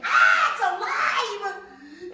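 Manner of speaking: very loud, high, slow shriek of horror; terrified scream. Acted. Man fleeing the living doll, horrified shriek. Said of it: "The lady screamed".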